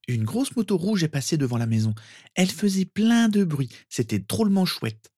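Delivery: put-on high child's voice, thrilled; excited small boy. Sirius telling cousin of a motorbike, excited. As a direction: gush like an impressed little boy.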